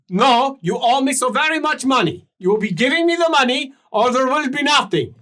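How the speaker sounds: high, loud and insistent creditor; voice from below. Acted. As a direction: press him to stay and pay. Creditor demands money.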